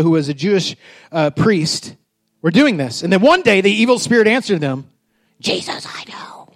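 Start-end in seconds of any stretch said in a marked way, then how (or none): 5.4-6.5 slow whispered demon; hushed, drawn-out demonic whisper; little demon voice. Acted. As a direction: whisper it like an evil spirit. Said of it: "there's my little demon voice for you".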